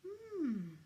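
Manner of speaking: high curious hum; curious hmm. Acted. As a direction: muse over the egg with a rising hmm. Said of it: "said Santa curiously".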